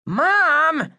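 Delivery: high, loud mortified whine; mortified kid cry; embarrassed protest. Acted. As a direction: protest the nickname. Boy embarrassed by nickname.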